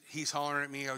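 ordinary delivery

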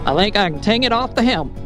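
ordinary talk, nothing marked

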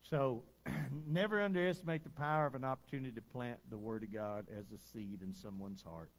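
ordinary talk

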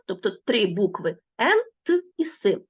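ordinary delivery

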